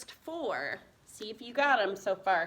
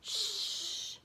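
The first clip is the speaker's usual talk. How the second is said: long soft hushing "shh"; "sh" sound; 10 semitones higher, 8 dB quieter than usual. Shown as a demonstration. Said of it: "S H says what?".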